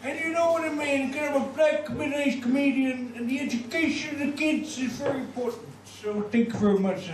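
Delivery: high-pitched rambling middle-aged American comedian; squeaky, animated elder comic parody; Bill Cosby. Acted. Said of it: "Mister Bill Cosby".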